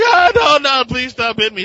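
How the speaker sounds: high, loud hammed-up whimpering plea; mock pleading. Crook being beaten by Bucky, in high loud mock begging. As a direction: beg him to stop, overdone. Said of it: "He's like".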